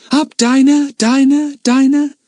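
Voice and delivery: loud, high repeated echo; echoes. A narrator's reading. The cave echoes repeating Lucy Ann's call. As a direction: let the name bounce off the walls. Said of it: "called the echoes".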